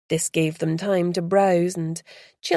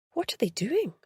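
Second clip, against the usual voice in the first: softer, higher hushed question; whispered back; 7 semitones higher, 6 dB quieter than usual. Acted. Beth asking the guide about the tourists, hushed. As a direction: lean in and ask under the breath. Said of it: "she whispered back".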